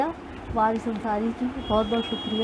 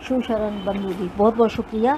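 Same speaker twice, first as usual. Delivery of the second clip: ordinary talk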